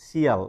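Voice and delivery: "শিয়াল" as two syllables, "see-al"; mispronounced ending. Shown as a demonstration. Teacher showing how we split the last syllable, mispronounced. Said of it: "দুটো সিলেবলে ভাগ করে".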